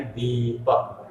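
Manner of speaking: दीपक with a stretched "deee"; long, unhurried vowel. Shown as a demonstration. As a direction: linger at ease on the ī of दीपक. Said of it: "उसको आराम से कहा जा रहा है".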